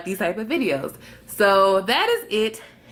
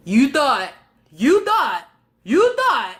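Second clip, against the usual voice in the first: rising higher with each repeat; increasingly high, emphatic mockery; mocking taunt; 6 semitones higher, 4 dB louder, slower than usual. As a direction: taunt in punchy repeats, climbing in pitch. Mocking herself for expecting good things.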